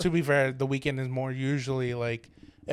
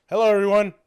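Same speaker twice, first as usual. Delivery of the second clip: louder, higher showy greeting to the room; hammed-up celebrity announcing himself; mock self-announcement; 5 semitones higher, 7 dB louder than usual. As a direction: pretend star introducing himself to everyone.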